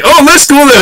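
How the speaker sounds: high, breezy sing-song invite; chirpy invite. Retold or imitated. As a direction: sell it as a fun outing. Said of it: "we'd say".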